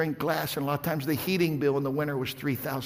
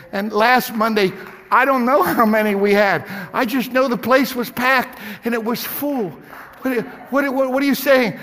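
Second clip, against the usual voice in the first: loud high fervent preaching; preaching at full force; 9 semitones higher, 10 dB louder than usual. Acted. Pastor preaching to his church, loud and fervent. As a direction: preach it out to the congregation.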